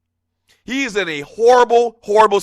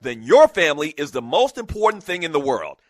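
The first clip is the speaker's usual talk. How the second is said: softer, calm sponsor read; 4 semitones lower, 4 dB quieter than usual. Acted. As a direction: read the ad sincerely. Reads sponsor ad.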